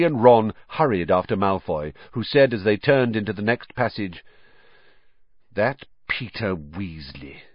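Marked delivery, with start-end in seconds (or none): 5.5-7.4 slow, soft, lazy dismissal; dismissive. A narrator's reading. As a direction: drop it carelessly. Malfoy dismissive.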